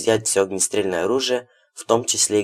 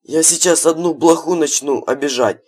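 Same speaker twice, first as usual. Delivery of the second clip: mocking threat; 7 semitones higher, 4 dB louder than usual. A narrator's reading. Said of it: "в тон ему ответил Хладов".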